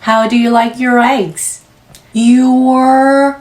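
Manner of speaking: very slow, sounds linked in one breath; slow linking drill. Shown as a demonstration. Teacher models the linked phrase slowly. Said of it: "R 모음 유지하고 있다가 eggs".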